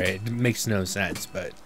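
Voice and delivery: usual speaking voice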